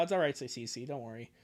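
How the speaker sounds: usual speaking voice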